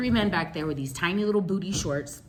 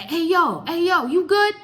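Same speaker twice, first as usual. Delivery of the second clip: high, loud friendly street call; calling out to a stranger; 10 semitones higher, 6 dB louder than usual. Retold or imitated. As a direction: hail her across the sidewalk, loud and high.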